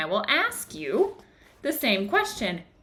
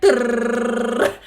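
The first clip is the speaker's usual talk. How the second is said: loud rolled trill for a pounding heart; racing heart noise; 3 semitones higher, 7 dB louder than usual. Retold or imitated. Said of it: "my heart was like".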